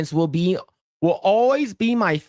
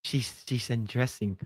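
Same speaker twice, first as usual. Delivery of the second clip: hushed Jackie Chan; 6 semitones lower, 10 dB quieter than usual. Retold or imitated. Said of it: "He's like".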